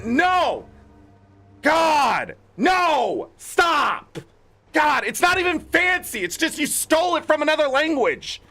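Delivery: high-pitched exasperated outburst. Real, not acted. Gamer ranting at writers over fancy words, exasperated. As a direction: cry out in fed-up protest.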